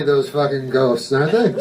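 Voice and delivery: ordinary talk, nothing marked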